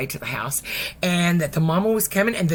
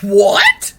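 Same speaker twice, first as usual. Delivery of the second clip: loud, incredulous shout; shocked exclamation; 3 semitones higher, 14 dB louder than usual. Retold or imitated. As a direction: blurt it out in disbelief. Mother hears shocking news.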